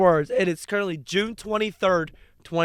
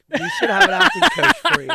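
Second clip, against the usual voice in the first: mock drama-teacher voice; 8 dB louder, much faster than usual. Mocking friend's bad accent.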